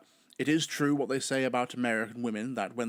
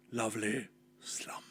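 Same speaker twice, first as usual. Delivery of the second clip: soft breathy whisper, drifting off; sleepy whisper; 3 semitones lower, 7 dB quieter than usual. Shown as a demonstration. Lulling listeners to sleep with a soft whisper. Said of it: "listening to my docile tones sent you into a deep".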